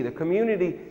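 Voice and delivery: usual speaking voice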